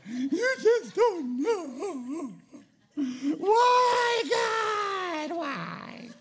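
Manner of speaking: high wailing cry of a whiny friend, hammed up; mock wailing complainer. Friend who didn't get their way, mock wailing cry. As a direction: overdo the sobbing grown friend crying why God. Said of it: "that act like that".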